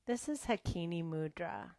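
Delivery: ordinary talk, nothing marked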